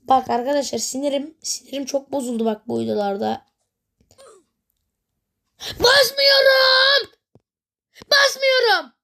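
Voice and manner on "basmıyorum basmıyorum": high, slow, drawn-out wailing shout; whiny shout. Real, not acted. Gamer wailing that the button won't press, whiny shout. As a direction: wail it at the broken controls. Button not working rage.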